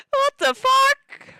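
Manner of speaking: slow, high, loud screech of disbelief; shocked shriek. Real, not acted. Streamer reacting to the fallen webcam, shocked shriek. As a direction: screech it, drawing the words out.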